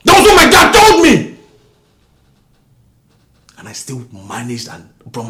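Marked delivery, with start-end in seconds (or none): 0.0-1.4 much higher, loud cry; bitter yell. Acted. Husband to wife about his father, bitter yell. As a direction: let it burst out.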